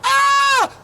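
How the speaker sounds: very high, loud imitated scream; brother's hammed-up scream as the scab rips off; mock scream of pain. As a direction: yelp like the kid slapping on aftershave. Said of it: "and he's like".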